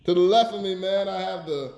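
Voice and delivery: loud opinionated ribbing announcer; podcast hype intro. Acted. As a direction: hype up the hosts like a ring announcer. Continues host introductions.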